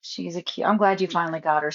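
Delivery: ordinary talk, nothing marked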